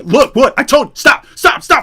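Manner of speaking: high, loud cry of stop stop; urgent yell. Retold or imitated. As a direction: shout at your friend to stop. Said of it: "you're like".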